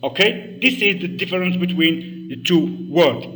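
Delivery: ordinary talk, nothing marked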